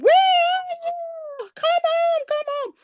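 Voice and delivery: high sing-song call across the neighborhood; imitating mom calling a child home from far off. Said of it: "My mom used to scream and yell".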